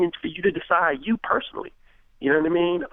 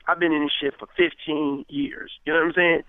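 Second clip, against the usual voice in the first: ordinary talk, nothing marked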